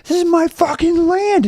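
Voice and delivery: high, furious yell imitating an outraged mother; slow, high-pitched retold rage; imitated screaming.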